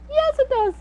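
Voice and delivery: very high, playful squeak; mock-squeaky reassurance; squeaky little voice. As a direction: answer yourself in a tiny high voice. Comic answer to own doubt.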